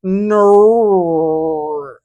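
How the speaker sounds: "no" in a loud, high, clumsy New Zealand vowel; attempted New Zealand "no", badly done. Shown as a demonstration. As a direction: try the Kiwi "no", stretched. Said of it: "I, I can't do it".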